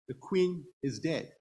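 ordinary delivery

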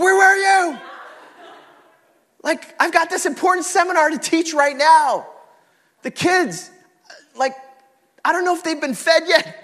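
high, loud, laughing rant at the wife; hammed-up yelling at a late wife; mock angry shout. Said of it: "I was like".

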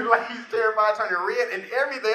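ordinary talk

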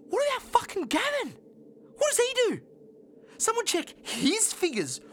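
high-pitched outraged venting; indignant rant. Acted. Indignant rant about a colleague.

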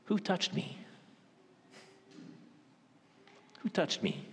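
soft, tender "Who touched me?"; gentle. Shown as a demonstration. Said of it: "This is the gentle Jesus".

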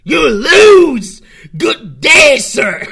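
high shouted Willy Wonka "You lose. Good day, sir."; Willy Wonka impression. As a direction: yell it like an outraged Wonka dismissing a guest. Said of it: "that really sounds like the, a Willy Wonka impression".

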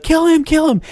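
high, frantic egging on; frantic urging. Retold or imitated. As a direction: shriek it like a bloodthirsty doll. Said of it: "she's like".

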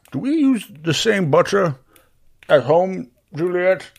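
high-pitched character question; inquisitive Barry. Acted. Barry asking Juliet about the food, high and curious.

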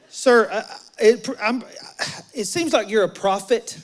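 hammed-up stammer, fumbling for words; flustered stammer. Acts woman flustered, changing the subject.

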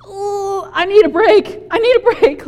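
high-pitched, hammed-up cry for a break; mock-overwhelmed groan into a plea. Jesus begs off from demanding crowds, comically overwhelmed. Worn-out Jesus begging for rest.

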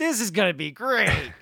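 high, loud excited outburst; gleeful anticipation. Real, not acted. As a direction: hype it up with relish. Hyped about coming road-rage story.

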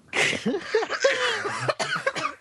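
laughing through a coughing fit. Real, not acted. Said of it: "I'm done choking now".

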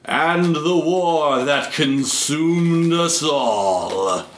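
slow epic trailer read; dramatic narrator. Acted. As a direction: build it up like a movie trailer. Dramatic intro to console war.

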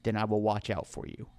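low, quiet, protective promise; hushed in-character reassurance; Roscoe. Acted. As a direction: make a soft, solemn vow. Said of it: "Roscoe kneels down to you and goes".

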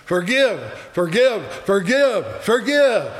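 high, rising in pitch with each repeat; new calling voice. Acted. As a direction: call it out insistently, building. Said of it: "coming from the cross is a new voice".

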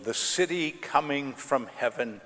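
ordinary talk

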